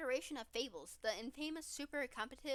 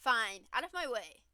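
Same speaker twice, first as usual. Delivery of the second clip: loud huff; 9 dB louder than usual. Acted. Polly storms off.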